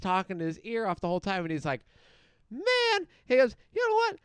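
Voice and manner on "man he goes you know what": put-on high, pinched old-timer voice; high mock geezer opener; put-on old man voice. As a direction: squeeze it up into a silly old man. Old man quoted, voice pitched up.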